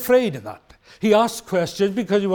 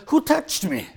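high, questioning Jesus voice; eager to know who touched him; Jesus asking; 8 semitones higher than usual. Retold or imitated. Jesus in the crowd, asking who touched him. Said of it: "And Jesus said".

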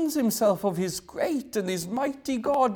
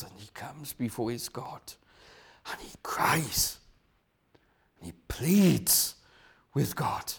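hushed; 5 semitones lower, 6 dB quieter than usual. Acted. Describing David pleading, hushed.

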